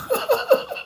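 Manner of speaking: high burst of real laughter; laughing. Real, not acted. Laughing at father crying.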